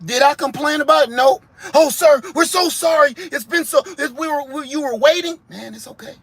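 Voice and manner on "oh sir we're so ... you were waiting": high, fast, flustered fast-food worker; over-the-top sorry cashier voice; apologetic worker. Acted. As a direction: gush apologies at the customer. Drive-thru worker apologizing.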